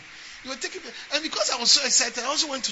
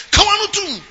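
mock command; 11 semitones higher, 12 dB louder, slower than usual. Preacher voicing a bossy child.

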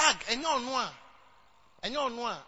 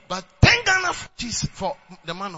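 indignant shout; 13 dB louder than usual. Real, not acted. Preacher scolding members over a ten cedi gift, loud outrage. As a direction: throw it at them in disbelief. Preacher mocking tiny offering.

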